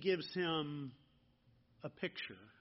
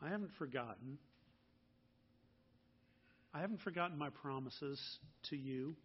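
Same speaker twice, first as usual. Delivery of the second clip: much softer, gentle reassurance from God to Abram; kind voice of God; 4 semitones lower, 6 dB quieter than usual. A narrator's reading. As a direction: soothe him with the promise. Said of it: "He speaks kindly to him".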